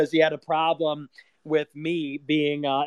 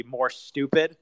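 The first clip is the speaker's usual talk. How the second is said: ordinary talk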